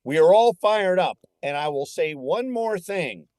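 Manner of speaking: rallying coach. Retold or imitated. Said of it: "And, uh, he says".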